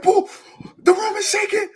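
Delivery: high, loud delighted cry; excited kid. Retold or imitated. Kid thinking the stampede shakes the room, squealing high. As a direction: marvel at the shaking room. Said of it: "I'm like".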